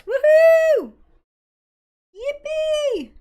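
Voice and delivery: high, loud celebratory whoop; excited cheer. Real, not acted. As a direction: cheer like you just won. Celebrating finding the file.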